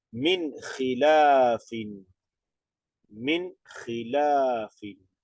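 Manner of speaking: "Min khilafin" read slowly, with no nasal sound on the nun; slow tajweed reading of "Min khilafin"; Arabic recitation demo. Shown as a demonstration. Teacher reading the example for students, slowly and unnasalized. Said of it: "entonces solo acentúa".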